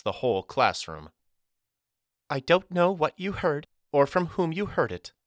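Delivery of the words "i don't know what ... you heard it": high-pitched, sugary teacher voice; falsely sweet chirp. A narrator's reading. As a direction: chirp it far too sweetly to be believed. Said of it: "chirped in tones far too sweet to be authentic".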